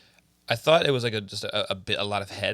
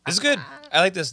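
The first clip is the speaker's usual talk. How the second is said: excited; 7 semitones higher, 6 dB louder than usual. Real, not acted. Excited beer reaction, loud.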